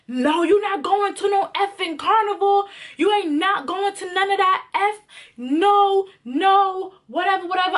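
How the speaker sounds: high, heated mock rant; hammed-up jealous yelling at her; imitating angry boyfriend. Boyfriend ranting at her about Carnival, high mock angry. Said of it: "He starts to go off on me again".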